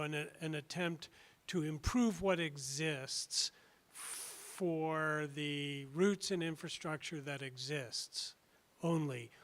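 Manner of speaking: usual speaking voice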